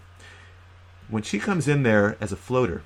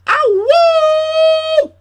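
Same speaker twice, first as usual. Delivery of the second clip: high, loud "ow" and long "woo"; mock yelp of pain; 13 dB louder than usual. Trainer acting out a bee sting, loud high howl. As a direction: howl as if just stung, pitched way up.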